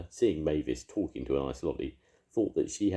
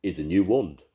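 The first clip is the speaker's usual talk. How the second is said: helpful; 4 semitones higher, 8 dB louder than usual. A narrator's reading. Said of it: "said Arthur helpfully".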